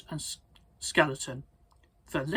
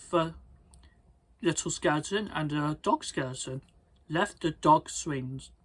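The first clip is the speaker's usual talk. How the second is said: ordinary delivery, nothing marked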